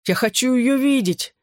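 high, insistent plea; protesting. A narrator's reading. Groggy boy demanding to see his girl, high protest. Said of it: "запротестовал я".

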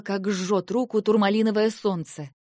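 plain reading voice

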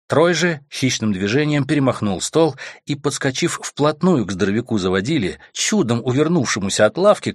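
ordinary delivery

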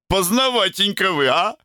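put-on very high, cheeky drunken tease; mock him like a drunk dandy. A narrator's reading. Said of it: "сильно навеселе". (пьяным голосом, насмешливо)

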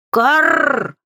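high cawing cry; crow caw. Acted. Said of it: "воскликнул нарушитель".

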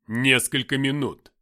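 slow, loud, mighty figure; commanding Lucifer. A narrator's reading. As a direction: pronounce it slowly with booming authority. Towering Lucifer gives orders.

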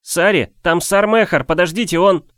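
high, loud urgent call. Acted. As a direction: shout after her to make her wait. Boy calls urgently after her.

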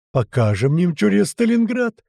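high, slowed rallying shout; rally the men to fight; battle cry. A narrator's reading. (боевой клич)

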